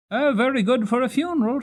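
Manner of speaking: a high, loud cabman's quip; gruff cabman. Acted. Cabman remarking on the new horse, high and loud. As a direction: judge the horse like a seasoned cabbie.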